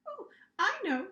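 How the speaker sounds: high, eager chirp; red monster eager to answer, chirping; chirpy monster. Acted. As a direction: chirp it like a keen pupil. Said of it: "chirped the red monster".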